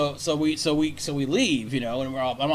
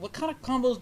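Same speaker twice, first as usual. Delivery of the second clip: mocking; 10 semitones higher than usual. Mocking the customer's question back.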